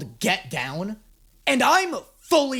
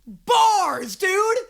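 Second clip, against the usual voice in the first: hyped shout; 10 semitones higher, much slower than usual. Real, not acted. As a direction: shout it out, blown away by the bars. Hyped reaction to the rap verse.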